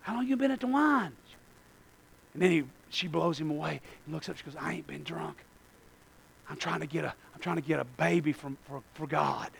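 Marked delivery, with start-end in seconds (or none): 0.0-1.1 softer, put-on questioning voice; lightly voiced judging onlooker. Christians questioning somebody in a mess, lightly mocked judging voice. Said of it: "we bend down and say". 4.6-9.5 very soft, low reply; quiet protest. Acted. Hannah explaining herself to the prophet, quiet low protest.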